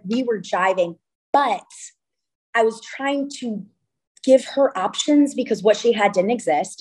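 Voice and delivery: ordinary delivery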